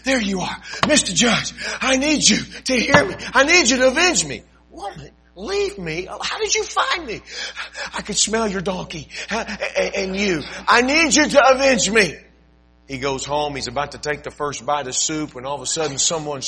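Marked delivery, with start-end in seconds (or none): 0.0-4.4 high, loud nagging widow voice; pleading widow. Acted. Widow finds judge at market. 4.7-7.2 high, exasperated cry; startled judge. Acted. 7.9-12.2 high, loud nagging widow voice; pleading widow. Acted. Widow answers judge.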